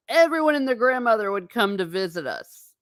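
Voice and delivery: ordinary talk, nothing marked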